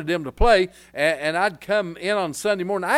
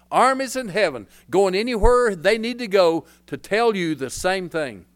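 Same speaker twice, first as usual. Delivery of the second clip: usual speaking voice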